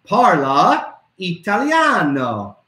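"Parla Italiano" slow and clear, falling at the end; teacher showing the written phrase, saying it slowly; slow Italian phrase. Shown as a demonstration. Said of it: "Say it with me".

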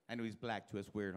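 ordinary talk

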